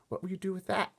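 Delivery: put-on high girlish voice; young girl. A narrator's reading. Sophie asks the giant, worried girl. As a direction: ask like a worried child.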